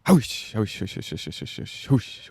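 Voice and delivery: breathy hoosh noises, fading; hooshing sound effect. Shown as a demonstration. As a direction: push air out in hooshes. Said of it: "they're just like".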